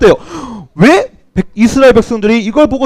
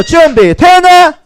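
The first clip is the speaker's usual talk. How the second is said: high, drawn-out loud call; rallying call; 7 semitones higher, 4 dB louder, much slower than usual. Real, not acted. Teacher asking children again if they are ready, rallying shout. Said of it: "다시 한번 더".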